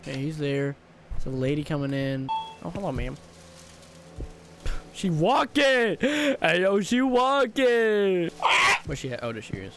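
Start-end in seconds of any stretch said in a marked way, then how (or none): 4.9-8.3 excited yell. Real, not acted. Gamer hyped that the customer is walking.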